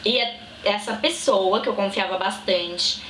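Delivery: ordinary talk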